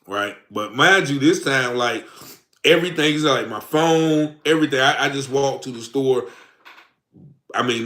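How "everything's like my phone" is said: ordinary talk, nothing marked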